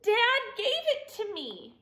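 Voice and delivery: high, plaintive voice; crying complaint. A narrator's reading. Douglas telling Rabbit Dad gave it, plaintive high cry. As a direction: whine it high, near tears. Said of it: "cried Douglas".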